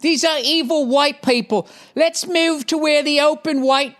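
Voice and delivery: high, slow mock English accent. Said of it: "hearing that stupid accent".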